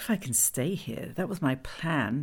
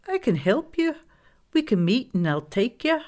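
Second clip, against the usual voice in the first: high, loud, insistent offer of help; eager Glenda character; 7 semitones higher, 5 dB louder than usual. Acted. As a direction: take charge in a high, lilting voice.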